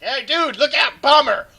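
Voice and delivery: high, loud shouted warning; retold warning shout. Caster retelling his ping to a teammate, loud warning yell. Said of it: "I was like".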